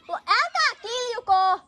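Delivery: high, drawn-out call across a distance. Real, not acted. Telling noisy screamers nearby to stop, high drawn-out call.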